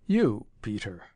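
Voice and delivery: slow, silky menace; suddenly silky. A narrator's reading. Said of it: "in tones suddenly silky".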